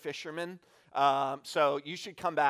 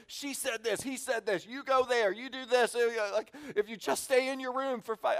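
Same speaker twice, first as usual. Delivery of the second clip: put-on higher-pitched, rapid refereeing of the kids; mock negotiator voice, pitched up and busy; frantic hostage negotiator; 9 semitones higher than usual. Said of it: "like you're a hostage negotiator".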